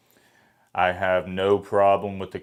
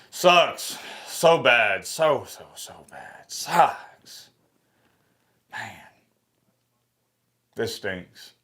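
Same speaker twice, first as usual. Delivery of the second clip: high bursts that sag in between; real pained venting; 3 semitones higher, much slower than usual. Real, not acted. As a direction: moan it out in short, high, wounded bursts. Venting heartbreak over team missing playoff.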